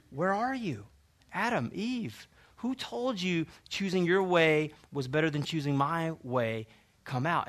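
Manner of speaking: soft, searching call of God; gentle calling. Acted. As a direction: call them out softly, with pauses. God's gentle altar call.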